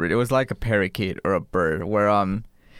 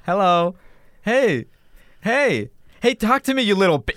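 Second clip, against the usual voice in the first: put-on higher, pushy kid calling hey at a toy bird; kid badgering toy; 7 semitones higher than usual. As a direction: kid trying to make the toy parrot answer. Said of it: "He was like".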